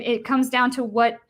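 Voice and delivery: usual speaking voice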